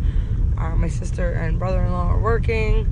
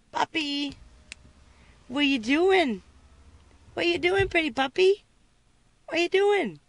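puppy talk; 10 semitones higher, 4 dB quieter than usual. Real, not acted. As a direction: coo at the dog, pitched way up. Baby-talking to a puppy.

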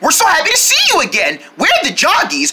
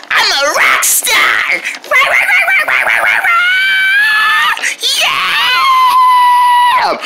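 wild, drawn-out high rock yells; hammed-up rock star shouting; much slower than usual.

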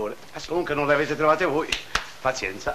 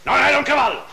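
high, louder indignant protest; exasperated denial; 6 semitones higher, 3 dB louder than usual. Acted. Jailer sets the prisoners straight about his loss, exasperated. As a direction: push the denial up high and loud. Jailer denies it was a horse.

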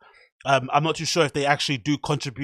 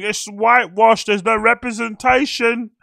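much higher and louder, shrill mock outrage; mock outraged activist; 11 semitones higher, 8 dB louder than usual. Vlogger mocking online critics with mock outrage. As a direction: ham up the indignant social media critic. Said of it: "one of those rah, rah people".

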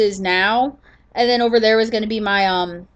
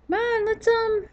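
high coaxing; 11 semitones higher, 6 dB quieter than usual. Real, not acted. Coaxing parrot, high pitch.

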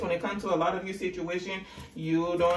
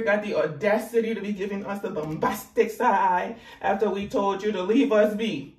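normal talking voice

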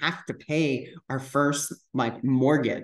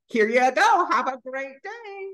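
high sing-out goodbye; retold high-pitched farewell; bright mock send-off; 10 semitones higher, 3 dB louder than usual. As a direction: chirp them out the door. Retold hurried send-off to parents.

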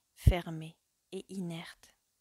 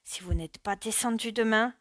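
angry warning; 3 semitones higher, 7 dB louder than usual. A narrator's reading. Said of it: "et il ajouta".

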